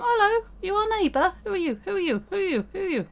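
high, squeaky repeated questioning voice; neighbour voice. Acted. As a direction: play the pushy neighbour, hammed up. Voicing neighbour NPC in quotes.

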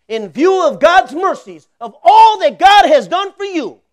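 high, loud, slow preaching swell; preaching climax. Real, not acted. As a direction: lift the church with a loud, slow cry.

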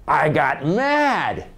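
drawn-out, emphatic mad outburst; slow, punched declaration of anger; acted anger. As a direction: stretch it out with mock fury. Acts out turning hurt into anger.

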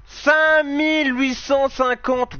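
high, slow and drawn out; astonished. Real, not acted. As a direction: stretch the number in disbelief. Shocked by point total.

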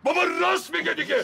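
loud, angry shout backing a friend up. Acted. Agency man shouting at the quarrelling creditor, angry. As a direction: snap it out at full volume.

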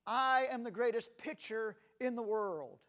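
loud, high, proud cry; triumphant boast. Retold or imitated. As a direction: crow it out triumphantly. Final boast, pitcher twist.

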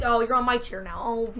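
normal talking voice

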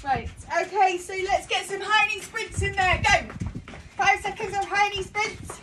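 loud coach call. Real, not acted. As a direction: call it out to the class. Coach calling out exercises.

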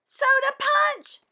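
very high, slow, loud sing-song; high squealed soda punch reveal; triumphant reveal. Acted. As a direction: sing out the answer high and proud. Amy reveals the answer.

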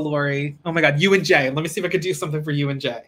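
ordinary delivery

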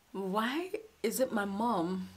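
slow, soft, broken question; asking why it had to be her mom; grieving question. Retold or imitated. Daughter asking why her mother died, softly grieving. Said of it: "one thing that I kept saying was".

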